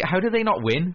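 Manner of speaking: high, disbelieving outburst; can't believe he lost; incredulous. Real, not acted. Outraged talent show viewer.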